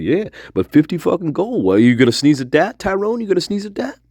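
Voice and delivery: high-pitched, insistent goading. Retold or imitated. Cousins goading Tyrone about the gold, insistent. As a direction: push them with high, rising questions.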